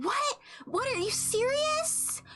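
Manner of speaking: high, slow mock-astonished "What, are you serious?"; shocked disbelief. Imitating the match's stunned reaction, high and stretched. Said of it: "Mind blown".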